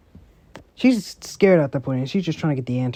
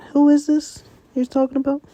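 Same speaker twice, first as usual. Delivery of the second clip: high, wondering question in another voice; high voiced character; 11 semitones higher than usual. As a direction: raise the pitch high for a different character. Character demands, far timbre, high.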